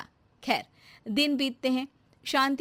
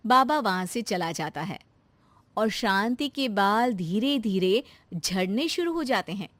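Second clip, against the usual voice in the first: ordinary delivery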